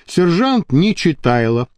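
ordinary delivery, nothing marked

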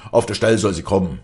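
loud, fast, urgent order; commanding. A narrator's reading. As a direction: snap out an order, loud and quick. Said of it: "befahl Stromminger".

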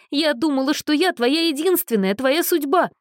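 high, whiny, tearful; whimpering. A narrator's reading. Abandoned girl whimpers at Anna, high and tearful. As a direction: whimper it like a hurt child. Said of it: "хныкала она".

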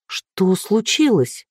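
slow, apprehensive question; a touch of fright; frightened. A narrator's reading. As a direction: ask slowly, dreading the answer. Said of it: "Она переводила испуганный взгляд".

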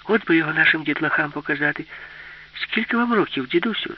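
put-on high-pitched childlike question; curious little girl. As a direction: ask like an eager child. Girl marvels and asks grandpa.

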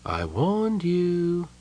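slow, high sighed reproach; drawn-out I-told-you-so; sighing. A narrator's reading. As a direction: sigh it out wearily.

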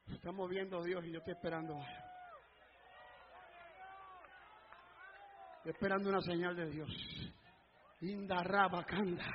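very low, soft, quick aside; hushed preaching. Real, not acted. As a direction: drop right down in hushed expectancy. Preacher drops to hushed waiting. (prédica en voz muy baja)

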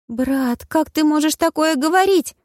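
put-on high, tearful girl's voice; a touch of a sob, high; Bridget sobbing. A narrator's reading. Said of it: "всхлипнула Бриджит". (высокий голос, всхлипывая)

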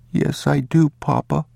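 put-on high, obedient child's voice; small boy answering his father; young boy. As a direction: repeat it high, like a little boy. Boy repeats what father said.

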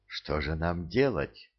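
anguished. Acted. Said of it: "с тоской спросил шаман".

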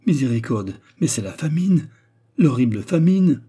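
high, loud cry of alarm; horrified exclamation. A narrator's reading. George learns all the food is gone, horrified. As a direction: wail it in theatrical horror.

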